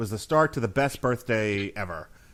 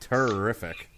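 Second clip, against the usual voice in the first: ordinary talk, nothing marked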